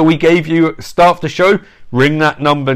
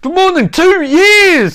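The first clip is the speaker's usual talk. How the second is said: sudden high, slow, louder read; high drawn-out voice; 3 dB louder, slower than usual. Pretend.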